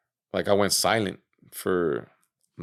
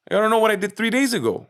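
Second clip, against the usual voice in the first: very high, rapid, incredulous; worked-up, quick-fire disbelief; retold exasperated protest; 12 semitones higher, 4 dB louder, faster than usual. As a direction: reenact baffled indignation at the question.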